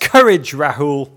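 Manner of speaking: loud rallying Courage, Rahul; rousing cry. Acted. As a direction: call Courage out like a battle cry. Second take, shouted.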